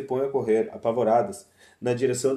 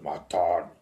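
low voice from the depths; lightly voiced talking earth; cavernous earth voice; 10 semitones lower, 3 dB quieter than usual. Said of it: "A terra falando".

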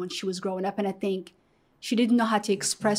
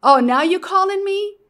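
high, loud sarcastic scold in disbelief; retold angry scolding; 7 semitones higher, 11 dB louder than usual. Grandmother scolding her granddaughter on the phone, loud and sarcastic. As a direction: snap at the caller as if she is finally calling. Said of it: "she started cussing me out".

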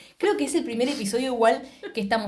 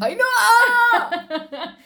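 very high, loud drawn-out yell; long, high-pitched scream of a name; shouted name; 12 semitones higher, 6 dB louder than usual. Real, not acted. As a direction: holler the name, stretching the end. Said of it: "grité Ainhoa de una forma".

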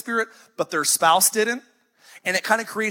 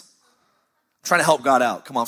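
normal talking voice

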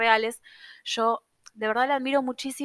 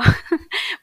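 laugh; 8 semitones higher, 8 dB louder than usual. Real, not acted. Laughs at student's compliment.

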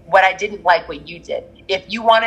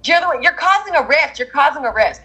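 mock accusers; 7 semitones higher than usual. Voicing people who yelled at her.

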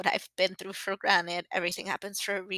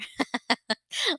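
bright laugh, pitched up; real laugh; 7 semitones higher than usual. Real, not acted. Laughing at her own song quote to the listeners. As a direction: let a real laugh burst out.

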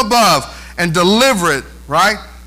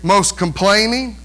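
ordinary delivery

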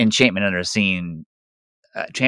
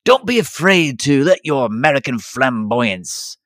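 slow, higher, put-on advisor voice; suave Percival; 5 semitones higher, slower than usual. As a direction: coax him like a smooth schemer. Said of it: "Percival was like".

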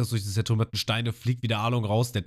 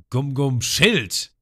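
slow, louder attack name; attack call; 6 semitones higher, 6 dB louder, much slower than usual. Retold or imitated. Ruffy calls his attack against Arlong, slow and loud. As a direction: call out the move deliberately. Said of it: "der sagt".

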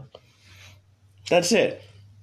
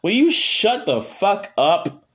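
exasperated retort; 6 semitones higher, slower than usual. Retold or imitated. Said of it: "And I'm just like".

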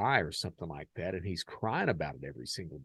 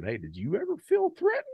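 mock asker; 5 dB louder than usual. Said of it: "would prompt the question".

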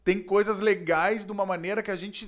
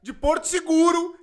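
mock angry scolding; 10 semitones higher, 7 dB louder than usual. Him scolding Roger about the wasted days, loud mock outrage. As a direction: chew Roger out, half joking.

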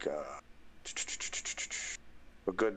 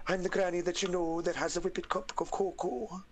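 high-pitched granny with a British brogue; high, lilting old-lady impression; Missus Doubtfire; 10 semitones higher than usual. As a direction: pitch it way up like a dotty nanny. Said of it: "whole Missus Doubtfire".

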